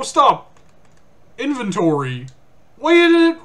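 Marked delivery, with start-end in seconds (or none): 2.7-3.4 high, loud alarmed cry; panicked shout. Real, not acted. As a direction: blurt it in panic. Panicked at game malfunction.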